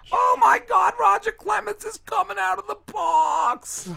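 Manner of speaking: high shrieking dramatic broadcaster; mock announcer. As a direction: squeal it like an overexcited announcer. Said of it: "all the dramatic things she's ever seen".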